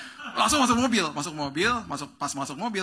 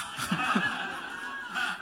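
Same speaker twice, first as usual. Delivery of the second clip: laughing; 4 semitones higher than usual. Real, not acted. Laughing after the joke.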